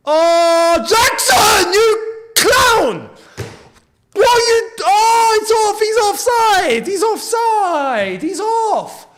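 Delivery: high, loud, exasperated yelling at the player; furious outburst. Real, not acted. Fan watching VAR rule the goal offside, furious yelling. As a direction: rage at Jackson for being offside.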